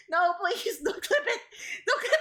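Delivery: high pleading whine. Real, not acted. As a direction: beg them, voice squeaking high. Begging chat not to clip.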